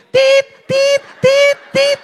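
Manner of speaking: high, loud, monotone electronic beeps; payphone beeps. Retold or imitated. Payphone cutting in on a lovers' call, high beeping. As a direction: imitate a payphone's warning tone, piercing and even. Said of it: "tiba-tiba dia langsung bunyi".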